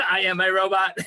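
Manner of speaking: stiff mechanical robot voice, laughing. Pretend. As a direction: play a robot, cracking up. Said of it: "Do it in a robot voice".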